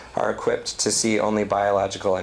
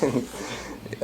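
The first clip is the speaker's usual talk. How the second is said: amused aside, breaking into a small laugh; quiet real chuckle at the end; 3 semitones higher, 8 dB quieter than usual. Real, not acted. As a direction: explain it, then let a soft chuckle out. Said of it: "I'm laughing".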